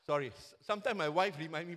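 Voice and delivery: ordinary delivery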